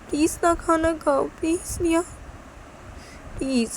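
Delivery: high, plaintive begging; pleading. Acted. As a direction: implore the child to eat. माँ खाने की मिन्नत करती है. (मिन्नत भरी ऊँची आवाज़)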